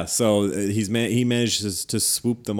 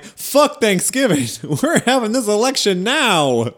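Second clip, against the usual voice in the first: alligator people; 10 semitones higher, 5 dB louder than usual. Retold or imitated. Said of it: "alligator people going like".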